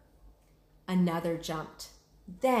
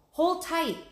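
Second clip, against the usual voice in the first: urgent Jack; 10 semitones higher, 5 dB louder than usual. Acted. Jack urges Annie.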